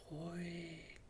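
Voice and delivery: soft, hushed whisper; whispering wind. A narrator's reading. The wind to the little hare, whispering. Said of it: "wispert der Wind".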